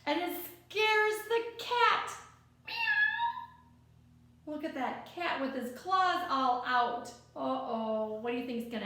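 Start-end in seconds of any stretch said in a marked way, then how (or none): 2.7-4.5 high, sudden meow; a scared cat's meow. Acted. Cat scared awake in story.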